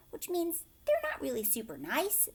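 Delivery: plain reading voice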